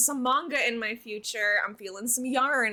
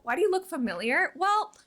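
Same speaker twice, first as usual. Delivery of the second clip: ordinary talk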